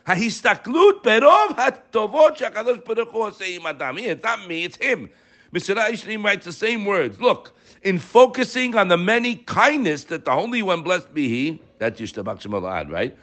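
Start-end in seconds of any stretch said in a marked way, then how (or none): 0.1-11.6 high-pitched, insistent sermon delivery; fervent preaching. Real, not acted. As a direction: preach it high, driving each phrase home. Preaches from quoted text.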